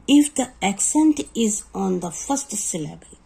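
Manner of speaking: slow, deliberate reading of a spelling rule; teacher dictating a rule to students, very slow; rule dictation. Shown as a demonstration. Reads English spelling rule slowly.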